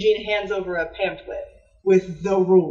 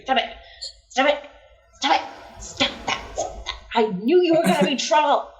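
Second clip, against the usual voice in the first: exasperated "stop it", rising to a higher fast outburst; mock scolding; 7 semitones higher, faster than usual. Inspector cat snaps at the nipping kitten, mock angry. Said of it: "I keep being like".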